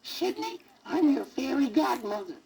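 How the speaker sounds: put-on high squeaky old fairy voice. A narrator's reading. Fairy godmother squeaking down at the sad elephant. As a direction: squeak it out like a tiny old lady. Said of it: "a strange voice squeaked".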